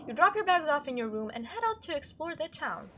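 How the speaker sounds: ordinary delivery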